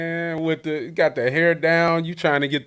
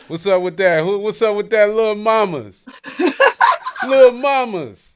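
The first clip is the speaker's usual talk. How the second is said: loud, high teasing call through laughter; laughing tease; 8 semitones higher, 8 dB louder than usual. Real, not acted. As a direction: crack up while calling her Lil Mamas. Host teasing over childhood photo.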